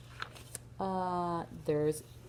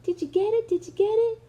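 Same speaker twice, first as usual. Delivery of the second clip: high, eager piggy voice; excited Piggy; 14 semitones higher, 7 dB louder than usual. Acted. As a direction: call out brightly as the little pig. Reads Piggy in a picture book.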